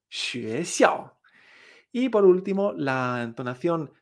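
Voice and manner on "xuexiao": "xuexiao" up, then down; tones two-four. Shown as a demonstration. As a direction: rise through "xue" and fall on "xiao". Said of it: "Dos cuatro".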